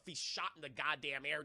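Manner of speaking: ordinary talk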